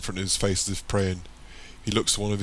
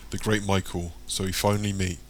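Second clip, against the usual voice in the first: ordinary delivery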